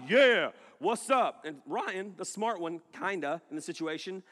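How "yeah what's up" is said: cocky greeting. Retold or imitated. Sitting smug in front row.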